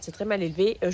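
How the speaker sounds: usual speaking voice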